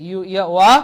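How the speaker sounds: ordinary delivery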